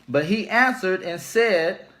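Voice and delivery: slow, measured Bible reading with each line weighed; solemn scripture reading. Acted. Preacher reading Matthew to his viewers, slow and solemn. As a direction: read the verse slowly and deliberately, like from the pulpit. Reads Jesus' words from Bible.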